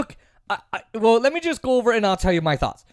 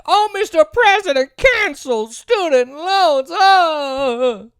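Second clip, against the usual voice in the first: much higher, whiny pleading mockery; mocking whiny activist; 5 semitones higher, 3 dB louder than usual. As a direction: parody their theatrical begging. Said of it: "theater-like thing, like".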